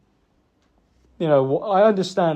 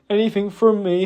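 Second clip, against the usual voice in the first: ordinary talk, nothing marked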